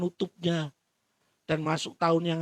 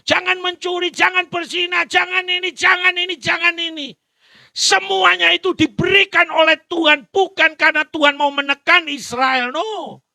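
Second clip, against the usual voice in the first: loud, high proclaiming of the commandments; preaching at full force; 11 semitones higher, 9 dB louder than usual. Acted. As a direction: proclaim each jangan to the congregation. (khotbah lantang tentang larangan)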